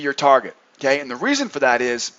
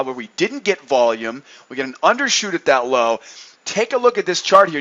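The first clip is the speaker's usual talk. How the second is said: usual speaking voice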